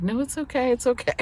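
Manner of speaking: high, soft soothing voice; lilting, hushed comfort, pitched well up; imitated reassurance. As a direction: play the technician gently reassuring. Voices technician reassuring her.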